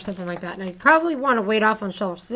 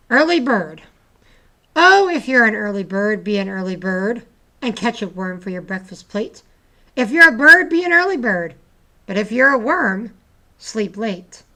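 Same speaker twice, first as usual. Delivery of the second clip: poem reading; 2 semitones higher, 7 dB louder than usual. Acted. Teacher reading a playful poem to the class, lively. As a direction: bounce the lines for the class.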